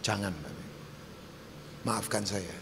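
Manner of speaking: low, soft, grave appeal; gentle pleading. Real, not acted. Preacher begs congregation not to bribe God with worship, soft low pleading. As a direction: plead quietly with long pauses.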